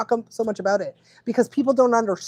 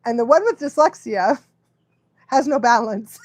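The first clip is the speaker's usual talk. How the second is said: ordinary talk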